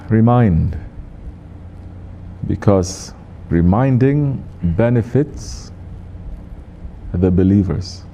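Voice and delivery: "reminding" stretched out very slowly, with a rising tune; slowed and emphasized. Shown as a demonstration. Imam stressing the verse to the congregation, very slowly. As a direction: drag out "Because reminding benefits" with weight on "reminding". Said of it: "I slowed down and emphasize".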